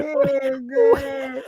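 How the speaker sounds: high-pitched wail of amusement; helpless amusement. Real, not acted. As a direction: wail it high through real laughter. Cracking up at the knockout clip.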